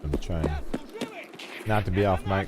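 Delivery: talking with chicken nuggets in the mouth; chewing crunchy nuggets between words; eating chicken nuggets. Real, not acted. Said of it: "some chicken nuggets".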